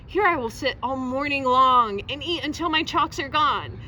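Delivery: plain reading voice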